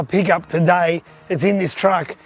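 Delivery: usual speaking voice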